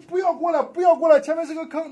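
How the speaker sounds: high, loud desperate warning shout; frantic roadside warning cry. Retold or imitated. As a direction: yell to stop the cars. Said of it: "他拦，他说".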